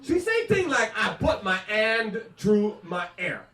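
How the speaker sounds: put-on thick French Canadian accent with dropped Hs; mock mother's French Canadian English. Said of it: "puts the H where there don't need to be the H".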